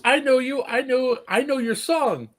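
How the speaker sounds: put-on very high, excited kid voice; little girl.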